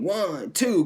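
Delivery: high, drawn-out imitation of a referee's count. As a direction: count it out like a wrestling ref. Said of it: "Rick Knox starts counting".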